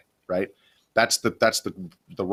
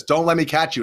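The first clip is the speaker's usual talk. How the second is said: imitating a cop's half-joking warning, pitched higher; joking threat; 6 semitones higher, 4 dB louder than usual. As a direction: tease it like a mock threat, raised pitch. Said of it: "he almost said it in".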